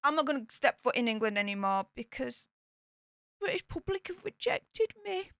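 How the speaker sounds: lightly mock a sulky duchess; mocking Meghan. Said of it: "The British public to be like".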